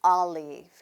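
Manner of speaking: olive starting with an open "ah", "ah-liv". Shown as a demonstration. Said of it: "한 번 더". (olive를 "알리브"처럼 아 소리로)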